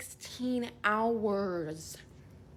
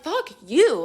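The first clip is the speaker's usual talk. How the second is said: higher, louder fuck you; retold curse, raised and sharp; angry retort; 6 semitones higher, 5 dB louder than usual. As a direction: snap it at the guards. Cursing at jailers from cell.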